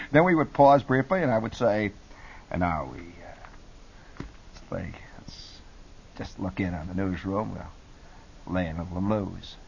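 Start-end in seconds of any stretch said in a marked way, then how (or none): 2.5-9.6 very soft, low late-night jazz voice; barely bother, murmuring with long gaps; hushed, laid-back cool radio host; absolutely cool DJ. Acted. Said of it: "I would be absolutely cool".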